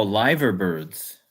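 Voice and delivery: "liver" said with a long i, like lyver, slowly. Shown as a demonstration. Streamer tries the chat's word, slowly saying "liver" as lyver. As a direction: sound out "liver" as lyver, drawn out. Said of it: "the birds are pronounced liver?".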